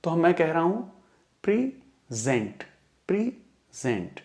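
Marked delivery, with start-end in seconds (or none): 1.4-4.2 present slowly broken in two, pri... ZENT; slow syllable-by-syllable pri / ZENT; split into syllables. Shown as a demonstration. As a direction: pause between the two parts of present, stressing ZENT. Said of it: "इसको हम दो भागों में बांटें".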